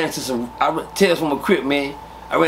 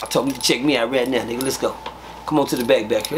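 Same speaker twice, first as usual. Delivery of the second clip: normal talking voice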